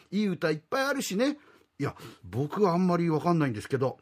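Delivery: ordinary talk, nothing marked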